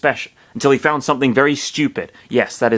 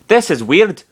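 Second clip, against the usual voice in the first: high, loud baffled remark; puzzled; 8 semitones higher, 5 dB louder than usual. A narrator's reading. Narrator voicing Falco at the empty glass, puzzled. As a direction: swoop up high in bewilderment. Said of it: "he trailed off".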